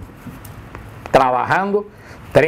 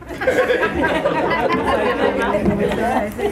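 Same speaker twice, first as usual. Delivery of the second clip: short laugh; 7 semitones higher than usual. Real, not acted. Se ríe de su propio chiste.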